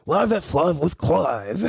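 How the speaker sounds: slow laughing anchor voice; lightly hammed-up anchor; mock news anchor. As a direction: announce it like TV news.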